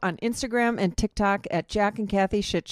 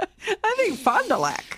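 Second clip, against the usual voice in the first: mock dreamy; 13 semitones higher than usual. Joking about a cheap destination.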